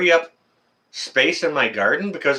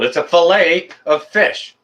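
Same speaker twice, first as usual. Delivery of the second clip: slow, emphatic "fillet", hit louder and higher; insistent correction; 5 semitones higher, 4 dB louder than usual. Real, not acted. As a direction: insist on "fillet", stretched and punched. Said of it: "I don't care where you go".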